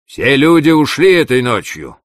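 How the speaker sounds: loud, high call across the yard; a shout thrown across to the other house; shouted from afar. A narrator's reading. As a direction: call it out from a distance. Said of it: "крикнул издали".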